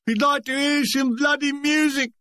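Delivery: high Cockney shout from the crowd; Cockney heckler. A narrator's reading. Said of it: "A cockney voice".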